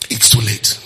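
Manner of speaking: ordinary talk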